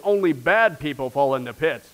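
a hint of a Pharisee looking down on the fallen; preacher lightly voicing a smug Pharisee who walks away; judgmental Pharisee. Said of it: "A Pharisee came along and says".

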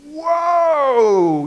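high, loud shocked whoa; startled whoa. Retold or imitated. Pastor reacting to donor's views, loud shocked whoa. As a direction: recoil in disbelief. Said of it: "I'm going".

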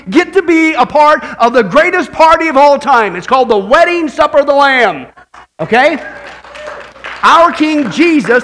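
loud, high fervent preaching; full-force preaching. Acted. Pastor rousing the congregation about the wedding supper, preaching loud. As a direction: preach it hard and joyful.

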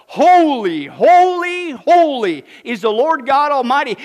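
loud, high, drawn-out proclamation; calling out. Acted. Seraphs calling to one another, exultant cry. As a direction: ring out each holy like a herald. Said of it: "they were calling to one another".

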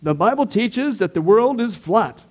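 high, slow, whiny skeptic voice; hammed-up skeptic sneering at the Bible; mocking skeptic. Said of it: "they have this premise like the Bible somehow contradicts science".

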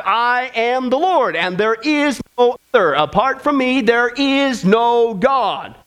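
slow, weighty words from Isaiah; solemn scripture reading. Acted. Preacher reading God's words to the congregation, slow and weighty. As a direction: let each word land like a verdict.

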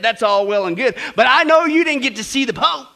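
loud, high doubting jab; scornful hairdresser. Retold or imitated. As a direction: needle her with disbelief. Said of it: "the hairdresser said".

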